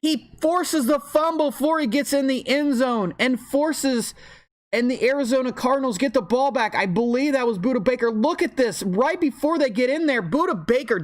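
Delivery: high-pitched, thrilled sports call; excited play-by-play. Real, not acted. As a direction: call the big turnover with rising excitement. Live call of a goal-line fumble.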